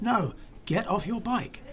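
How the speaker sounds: mildly acted scolding; retold insistent order. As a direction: imitate a stubborn man insisting, without real volume. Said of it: "he shouted at me".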